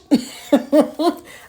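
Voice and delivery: bright burst of laughter; laughing. Real, not acted. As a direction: laugh out loud at yourself. Laughs at own retainer speech.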